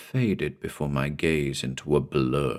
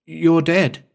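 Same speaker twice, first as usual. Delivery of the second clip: high, loud terrified scream; 10 semitones higher, 4 dB louder than usual. Acted. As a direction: scream it in horror at the dead man. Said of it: "It was a scream".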